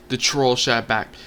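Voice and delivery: ordinary talk, nothing marked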